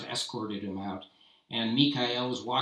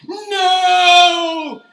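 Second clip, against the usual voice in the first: desperate shout; 19 dB louder than usual. Acted. Mikhail protests execution.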